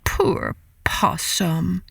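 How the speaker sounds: ordinary delivery, nothing marked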